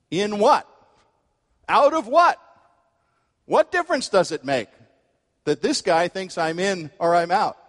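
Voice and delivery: a bystander's scornful, squeaky what; incredulous bystander. Retold or imitated. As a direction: scoff at the nut on the platform. Bystanders mocking the strange man.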